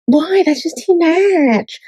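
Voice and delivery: high, slow mocking imitation of her ex. Vlogger mocking her ex's objections in a high, slow voice.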